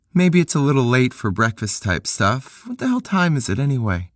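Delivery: put-on high young woman's voice, friendly invite; Peg's voice. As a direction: voice Peg inviting him in. Narrator voices Peg.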